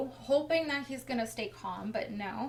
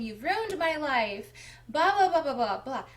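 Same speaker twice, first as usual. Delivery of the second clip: raised, higher imitation of an angry rant; mock screaming; 3 semitones higher, 5 dB louder than usual. Husband stopping wife as she leaves, mock angry rant. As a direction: mimic his outburst, tailing off into blahs. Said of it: "He's screaming right in my face".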